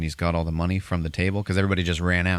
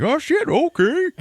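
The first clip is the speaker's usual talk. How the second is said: high, slow, loud squeak of surprise; hammed-up startled bartender; mock surprise; 7 dB louder, slower than usual. Bartender sees the money.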